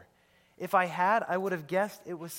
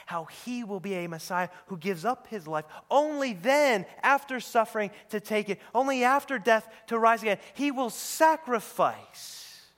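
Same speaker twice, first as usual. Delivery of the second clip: louder and higher, building to a climax; impassioned preaching; 7 semitones higher, 10 dB louder than usual. Real, not acted. Preacher telling of the Messiah's suffering, impassioned and building. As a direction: drive it home, stretching the final phrase.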